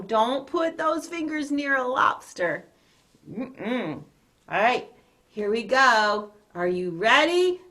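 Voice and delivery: ordinary delivery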